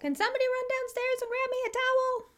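high, sing-out mock yell; yelling from bathroom. Somebody stuck in the bathroom wanting a towel, yelling. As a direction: call downstairs in a put-on high holler. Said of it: "somebody yelling from the bathroom".

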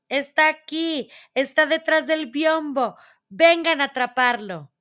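high, loud witch's cry of discovery; triumphant shriek. A narrator's reading. Witch calling the others to catch the hidden child, shrieking triumphantly. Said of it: "chilló triunfante".

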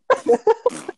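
loud, high burst of laughter; laughing. Real, not acted. Storyteller cracks up over the overflowing toilet, laughing. Laughs at his own mishap.

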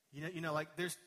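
ordinary delivery

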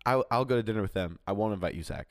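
ordinary talk

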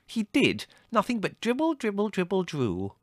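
put-on very high, squeaky-girl insistence; indignant sister. A narrator's reading. Margot insisting to her brother that he dribbled, indignant.

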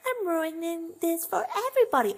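put-on high, teary little-girl voice with a laugh-cry; whiny little sister. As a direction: play a five-year-old wallowing in self-pity. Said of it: "at five years old being like".

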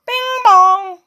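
high, loud cartoon cry. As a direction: call it out high and bright like a bell. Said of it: "He cried".

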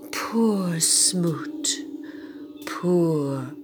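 soft, sorrowful sighing; pitying. Acted. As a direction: pity poor Smoot softly. Pitying hushed narration.

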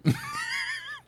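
squealing laugh; high laugh. Real, not acted. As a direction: shriek of laughter. Laughing with food in mouth.

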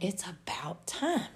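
slow whisper; whispered reply. Retold or imitated. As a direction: whisper it slowly, like a knowing aside. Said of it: "he's like".

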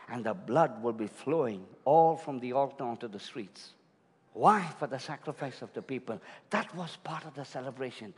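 low, softened, solemn sermon storytelling; hushed preaching. Real, not acted. Preacher describes the Passover lambs to congregation, low and solemn.

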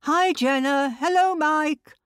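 high, loud, bright-faked hello called outward; yelling a fake greeting. Woman calls out to passing students to fake company, loud forced greeting. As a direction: yell cheerily as if friends are near. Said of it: "open the front door and yell".

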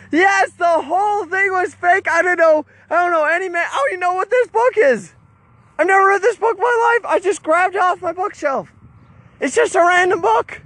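high, insistent retold outburst; animated, high-pitched admission; emphatic confession. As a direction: own up to it all in a high, worked-up voice. Retold exasperated confession to viewers.

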